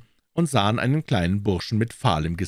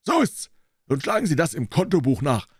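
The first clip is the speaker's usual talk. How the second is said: higher, pushy dealer lecturing a doubter; triumphierender Händler; 8 semitones higher, 4 dB louder than usual. Acted. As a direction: make him read the proof aloud. (auftrumpfender Händler, hohe Stimme)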